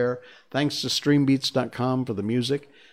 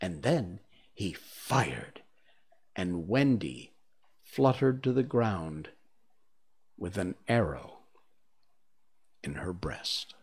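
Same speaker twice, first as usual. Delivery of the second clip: slow, falling away to a hush; hushed, suspenseful storyteller with long pauses; grave narration; 4 semitones lower, 5 dB quieter than usual. A narrator's reading. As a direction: let the tragedy sink in, fading softer. Tragic climax of chapter.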